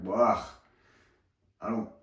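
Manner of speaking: ordinary talk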